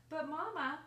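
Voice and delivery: put-on loud, high call of a child; pleading child. A narrator's reading. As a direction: call out to mama like an eager kid. Child pleads with mother in story.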